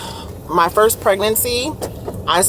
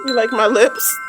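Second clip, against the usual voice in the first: pet talk. Real, not acted. Asking the dog.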